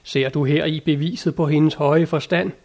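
usual speaking voice

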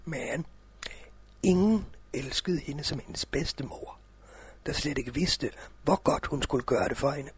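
soft cosy storytelling. A narrator's reading. Opening of a read fairy tale.

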